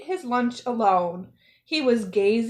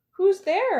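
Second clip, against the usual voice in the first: high, loud startled question; startled call; 9 semitones higher, 5 dB louder than usual. A narrator's reading. Quentin hears a pebble at night, startled call. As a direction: call out into the dark, alarmed.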